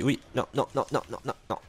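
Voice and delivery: usual speaking voice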